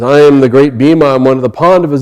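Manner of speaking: loud, grand self-announcement; proud declaration. Acted. Bhima introducing himself to the monkey, proud declaration. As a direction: proclaim your name and rank loudly.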